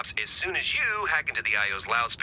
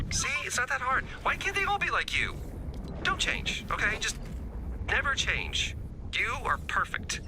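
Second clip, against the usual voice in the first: high-pitched, delighted gushing; gushing praise; 11 semitones higher than usual. Acted. Instructor to the one looper who got it right, gushing. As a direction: gush over a star pupil, voice leaping up.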